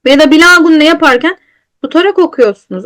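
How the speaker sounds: ordinary delivery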